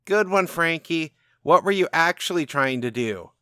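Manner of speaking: put-on high, loud sarcastic teen girl; teen girl mocking. Teen girl across the street mocking Frankie, high sarcastic call. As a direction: taunt him from across the street. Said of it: "from a teen girl on the other side".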